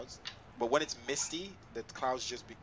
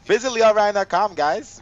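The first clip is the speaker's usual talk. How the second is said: louder, higher promo plug; ad read; 8 semitones higher, 17 dB louder, slower than usual. Acted. Plugging his website.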